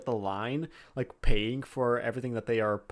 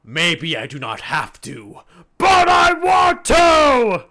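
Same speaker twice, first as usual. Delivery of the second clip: booming, slow, overjoyed himbo; dopey sweet himbo; 8 semitones higher, 13 dB louder than usual. Acted. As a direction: shout it like a delighted big lug. Bang insists.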